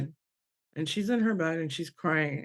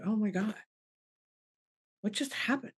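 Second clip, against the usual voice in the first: hushed, stunned retelling with raised pitch at the end; overwhelmed disbelief; 3 semitones higher, 7 dB quieter than usual. Retold or imitated. Mother retelling seeing her daughter move, hushed disbelief. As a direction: say it softly, as if stunned. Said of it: "now we're crying uncontrollably".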